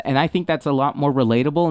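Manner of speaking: ordinary delivery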